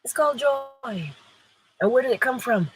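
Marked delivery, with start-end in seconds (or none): 0.0-1.1 drawn-out high joy; slow emphatic. Real, not acted. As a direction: linger on called joy.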